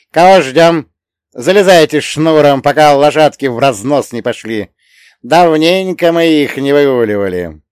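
gruff coachman. Acted. Coachman spirit hurrying them.

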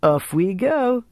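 cheerful Frenchman in English; French accent in English. A narrator's reading. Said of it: "he said in English".

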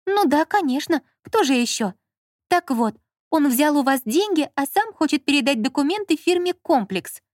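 high, heated woman's voice; Lola as wronged wife. A narrator's reading. Lola warning the director about her husband, aggrieved.

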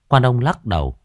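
ordinary delivery, nothing marked

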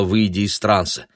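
plain reading voice

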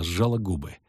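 ordinary delivery

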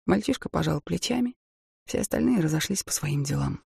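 ordinary delivery

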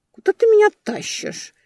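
put-on high, loud drunken suspicion; suspicious question. A narrator's reading. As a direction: ask it suspiciously. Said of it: "подозрительно поинтересовалась".

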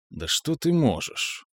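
quiet, slow, doubtful question; unsure, hesitant voice; uncertain. Acted. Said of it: "неуверенно произнёс он".

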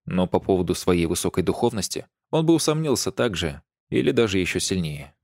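ordinary delivery, nothing marked

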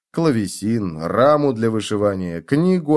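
ordinary delivery

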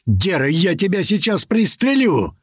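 high, urgent command voice; shouting. A narrator's reading. Commander ordering doors shut and threatening Gera, shouting. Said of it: "вновь закричал Туман".

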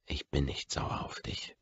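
tonlos und leise geflüstert; flüstere die Lüge, damit niemand mithört. A narrator's reading. Heimliches Gespräch am Esstisch. (tonloses leises Flüstern am Tisch)

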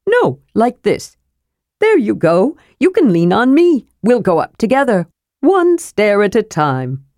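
a brisk, friendly kender offering help; kender Tass voice. Acted.

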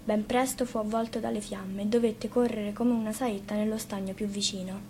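ordinary delivery

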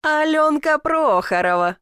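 high, slow exclamation of her name; surprised recognition. A narrator's reading. Second twin recognises the girl in the bushes, drawn-out surprise. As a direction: draw the name out in amazed recognition.

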